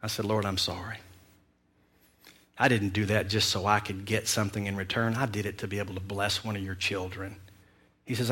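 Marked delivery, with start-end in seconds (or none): 0.2-7.4 quiet, humbled plea; bow your head and say sorry softly; contrite apology. Retold or imitated. David apologizing to the Lord, quiet and humbled.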